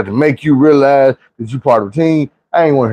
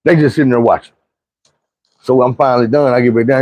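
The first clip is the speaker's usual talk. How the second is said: normal talking voice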